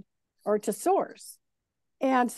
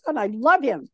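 ordinary talk

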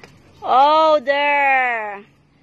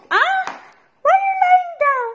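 much higher, fast squeaky pet voice; high dog-talk; faster than usual. Real, not acted. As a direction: coo at the dog in a high quick voice.

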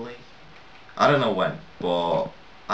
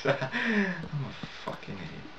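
laughing; 7 semitones higher, 9 dB quieter, slower than usual. Real, not acted. Laughs at himself.

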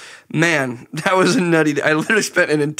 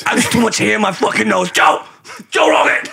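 mock yell; 7 semitones higher than usual. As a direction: shout for Joe like a hammed-up crank. [name] bit yelling at Joe.

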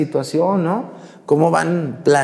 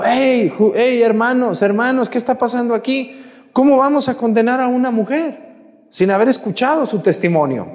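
put-on young Daniel calling out; 9 semitones higher than usual. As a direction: rouse the people, high and urgent. Young Daniel calls out to crowd.

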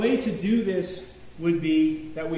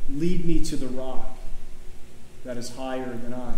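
scripture recitation; 5 semitones lower, 5 dB louder than usual. Acted. Reading the painting's scripture words.

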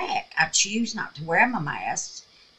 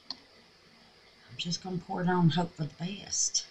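whispering; 6 dB quieter than usual. Real, not acted. Whispered aside while pouring.

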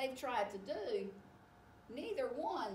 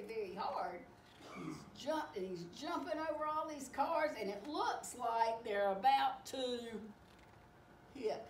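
plain reading voice

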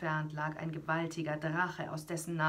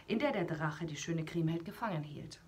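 ordinary delivery

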